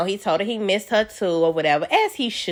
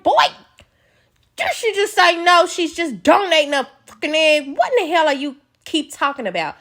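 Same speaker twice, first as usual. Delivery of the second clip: exasperated scolding; 7 semitones higher than usual. Retold or imitated. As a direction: scold him like he's lost his mind. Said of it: "I'd be like".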